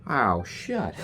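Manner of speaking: normal talking voice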